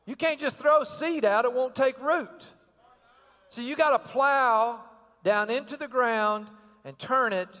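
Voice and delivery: rising, driving preacher's cadence; full-force preaching. Acted. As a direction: preach it hard and build.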